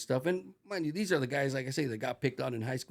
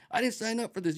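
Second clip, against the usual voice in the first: indignant recruit protest; 10 semitones higher than usual. Retold or imitated.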